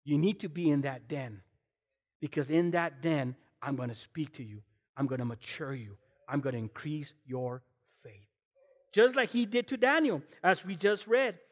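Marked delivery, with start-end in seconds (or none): 0.0-8.3 low, soft voice of God. Acted. God answering the praying man, low and calm. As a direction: speak as God, deep and gentle. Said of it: "And God says".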